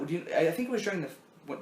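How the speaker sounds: usual speaking voice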